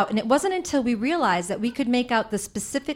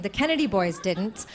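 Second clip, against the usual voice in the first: usual speaking voice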